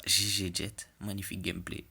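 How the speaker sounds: ordinary talk, nothing marked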